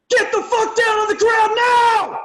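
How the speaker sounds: full-force high scream, slow and drawn out; loudest booming yell. Shown as a demonstration. Lawyer re-enacting his closing to the jury, loudest booming scream. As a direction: bellow it to fill a big courtroom. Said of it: "this is my loudest booming voice".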